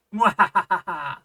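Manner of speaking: loud, sinister mad scientist cackle; evil laugh. Pretend. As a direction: cackle like a villain in his lab. Evil laugh in creepy room.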